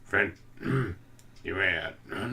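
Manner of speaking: abandoned impression with a throat clear; Sling Blade. Husband retries the movie voice and gives up, halting impression. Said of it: "I'm not even doing it right".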